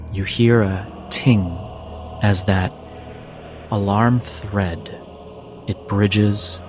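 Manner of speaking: usual speaking voice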